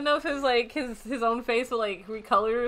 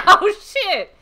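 high, loud cry of sudden pain; real pain yelp; 8 semitones higher, 13 dB louder than usual. Real, not acted. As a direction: yelp in pain from laughing too hard. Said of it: "I got a fucking pain in my boob".

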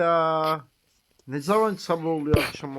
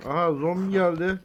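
ordinary delivery

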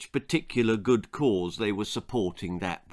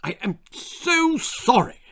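high, emphatic apologising husband; effusive apology; 12 semitones higher, 3 dB louder than usual. A narrator's reading. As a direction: apologise heavily to your wife. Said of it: "apologised Lord de Trop".

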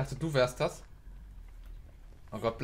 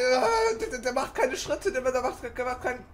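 panicked; 10 semitones higher, 4 dB louder than usual. Real, not acted. Panicked gameplay, killer nearby.

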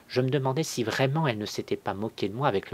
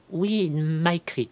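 high, slow, drawn-out answer; daring lie; 9 semitones higher, 4 dB louder, slower than usual. A narrator's reading. Cousin lying about her husband's letter, high and slow. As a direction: venture the lie in a raised voice. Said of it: "Donc elle osa mentir".

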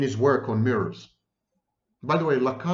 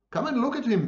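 high-pitched, eager call; storyteller's high cry; 10 semitones higher, faster than usual. A narrator's reading. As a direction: call them over, voice pitched right up. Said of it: "the brothers cried".

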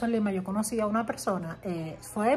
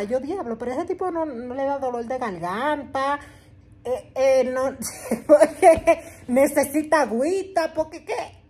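amazed inner thought; 4 semitones higher, 6 dB louder than usual. Retold or imitated. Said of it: "yo me quedaba así".

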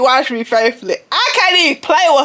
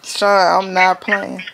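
mock serious; 4 semitones lower than usual. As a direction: pretend to be dead serious. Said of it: "I'm serious".

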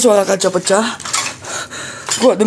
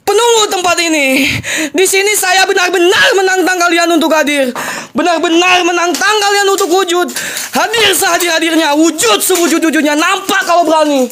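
mock shaman invocation; 9 semitones higher than usual. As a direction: call out the spirits like a bold dukun. Ghost-hunting challenge invocation.

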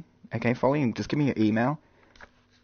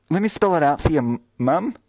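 higher and louder, spelling it out; exasperated emphasis; 5 semitones higher, 4 dB louder than usual. Shown as a demonstration. Streamer spelling out Moss to a viewer, exasperated and louder.

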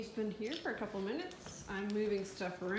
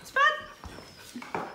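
calling the dog; 10 dB louder than usual. Real, not acted.

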